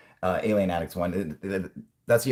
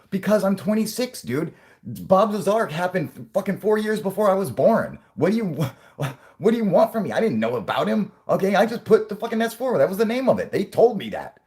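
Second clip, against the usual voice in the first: higher, louder, exasperated defence; whiny young staffer; 12 semitones higher, 5 dB louder than usual. Pretend. Jim protesting to his boss, high and exasperated. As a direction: plead that it's not your fault.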